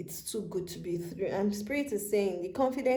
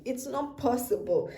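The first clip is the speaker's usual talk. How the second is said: ordinary delivery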